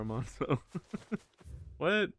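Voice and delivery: usual speaking voice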